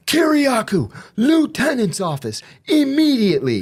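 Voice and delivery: high, drawn-out call-out; imitated prison summons. Officer summoning an inmate after a fight, imitated call-out.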